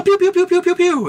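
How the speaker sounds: very high, rapid toy-laser pews; mock gunfire noises. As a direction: fire off squeaky shots with the voice. Imitates government gunfire in reply.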